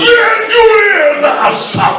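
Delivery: very high, slow, wailing stage voice; mock theatrical cry. Mocking Geoffrey Robertson's confession to the audience, hammed-up tragic wail. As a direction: wail it like a hammy tragedian.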